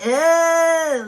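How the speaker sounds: loud mock disgusted groan; disgusted ugh. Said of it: "And then they was like".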